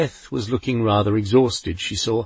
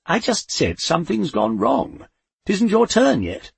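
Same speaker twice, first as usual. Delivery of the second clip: irritated Death; 8 semitones higher than usual. A narrator's reading. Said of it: "Death snapped".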